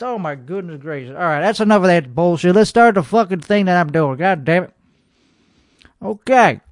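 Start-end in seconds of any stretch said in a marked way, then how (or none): none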